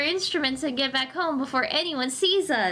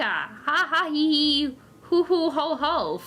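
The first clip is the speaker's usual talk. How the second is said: villain laugh. Acted. Villain gloats.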